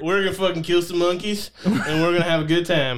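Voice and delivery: rally them in a mock Southern twang; hammed-up Southern good old boy; Southern accent. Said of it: "I don't know why they got Southern".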